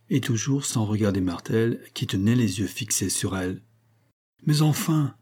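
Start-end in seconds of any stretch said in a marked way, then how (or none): 4.5-5.1 higher-pitched, mildly worried protest; worried exclamation. A narrator's reading. Lataste pressing Armande after her refusal, worried exclamation. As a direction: protest with rising unease. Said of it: "s'écria Lataste, ne riant plus et se montrant même inquiet".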